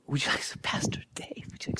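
soft whispered offer. Retold or imitated. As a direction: hush it like a hostess coaxing.

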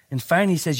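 ordinary delivery

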